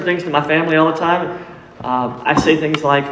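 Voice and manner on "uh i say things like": ordinary talk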